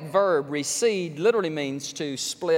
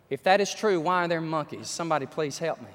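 usual speaking voice

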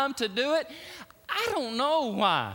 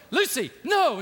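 louder shouted warning of Aslan; Aslan shouting; 5 semitones higher, 6 dB louder than usual. Retold or imitated. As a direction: shout her name to stop her. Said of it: "He goes".

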